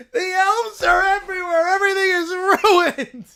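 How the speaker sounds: high, loud whiny cry; mock despair; despairing wail. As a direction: moan it like all is lost. Character despairing.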